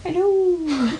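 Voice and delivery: put-on Gordon Ramsay doing an old lady; soft high old lady hello. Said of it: "That's my new voice".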